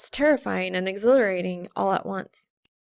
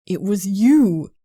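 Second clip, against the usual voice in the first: louder, slower, landing on you; Soul finally confessing, exasperated; accusatory; 9 dB louder, slower than usual. A narrator's reading. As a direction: jab the blame at her. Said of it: "nearly accusatory".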